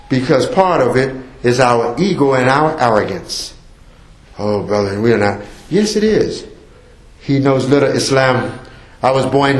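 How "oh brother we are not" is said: mock denial. Mocking a denying listener.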